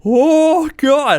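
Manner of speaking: very high, loud shocked 'Oh, God'; shocked cry. Retold or imitated. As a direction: cry out as the bullets just miss your face. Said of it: "I'm like".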